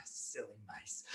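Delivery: low, very soft gloat; hushed, self-satisfied character aside; smug aside. Acted. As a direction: murmur it low to yourself. Smug neighbour gloats to himself.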